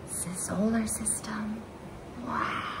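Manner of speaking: hushed wondering whisper. Real, not acted. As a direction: whisper in quiet awe. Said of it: "aside to listener".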